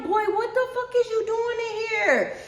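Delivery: high, loud outraged shout; shocked angry yell. Retold or imitated. Storming into his room to find his brother, high outraged shout. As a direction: yell it like you can't believe your eyes.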